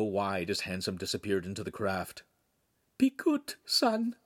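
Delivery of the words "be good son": high, weak tearful goodbye. Acted. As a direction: barely hold it together. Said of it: "she said weakly".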